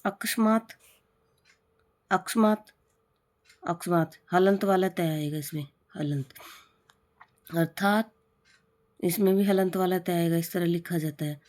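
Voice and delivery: normal talking voice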